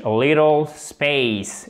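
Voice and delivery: "A little space" slowly, flapped T and full AY in "space"; American English. Shown as a demonstration. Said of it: "en American English". (« A little space » lentement, à l'américaine)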